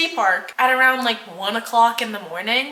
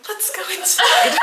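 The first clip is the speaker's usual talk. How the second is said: laughing; 12 semitones higher than usual. Real, not acted. Laughs retelling.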